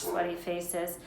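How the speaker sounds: ordinary delivery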